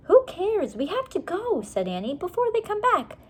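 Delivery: put-on a little girl pushing to hurry; Annie's voice. A narrator's reading. Annie urging Jack to leave the storeroom, impatient.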